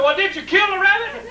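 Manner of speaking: high, insistent question; demanding dad. Retold or imitated. Dad quizzes Bill about the rabbit, demanding.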